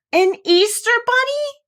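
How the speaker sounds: high, loud, slow delighted gasp; excited cry. Acted. The dolls discover the Easter bunny, high and thrilled. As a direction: squeal it out in wonder. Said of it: "all the dolls cried".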